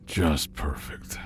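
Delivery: whispered in despair; muttered whisper. Acted. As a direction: breathe it out, defeated. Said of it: "Tom groaned".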